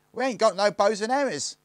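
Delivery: very high, loud objection; high-voiced gang boy. A narrator's reading. William Tell objects to the plan, high loud voice.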